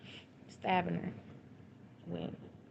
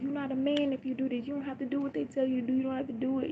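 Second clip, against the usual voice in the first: high, insistent urging; retold pleading; 8 semitones higher, faster than usual. Mother urging the man he need not obey them, pleading. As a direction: press him hard in a raised pitch.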